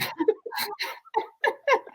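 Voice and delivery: high, breathy laugh at own joke. Real, not acted. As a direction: crack up at the punchline.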